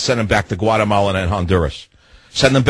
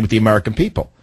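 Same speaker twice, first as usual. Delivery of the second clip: ordinary delivery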